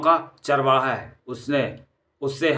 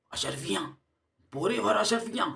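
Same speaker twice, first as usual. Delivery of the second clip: greedy murmur; 6 semitones higher, 8 dB quieter than usual. Acted. Thugs overhear about the gold.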